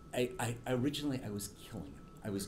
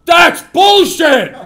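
much higher and much louder, slow drawn-out yell; imitated loud shout; 26 dB louder, much slower than usual. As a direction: bellow it like a deaf old man in the back. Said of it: "that tone of voice and that loud".

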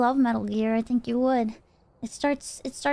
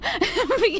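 laughing; 7 semitones higher than usual. Real, not acted. Laughs at own story.

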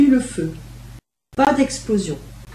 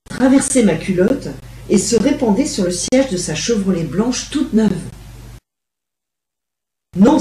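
usual speaking voice

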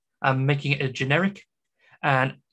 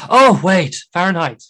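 loud, higher mock "oh, wait"; acted sudden realization; 4 semitones higher, 11 dB louder, slower than usual. Brit realizing Americans mean Fahrenheit, acted sudden realization. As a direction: act out the penny dropping, loudly.

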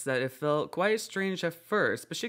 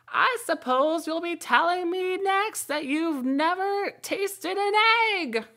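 very high, loud, slow insistent bird voice; accusing pigeon; 6 dB louder, slower than usual. A narrator's reading. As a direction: nail her as a serpent, pigeon-style.

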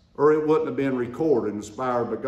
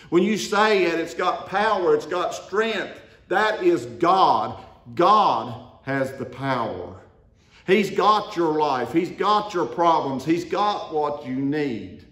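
loud, higher, driving sermon voice; fiery preaching; 3 semitones higher, 4 dB louder, slower than usual. Acted. Preacher to his congregation, loud fiery preaching. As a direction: preach it out to the back pews.

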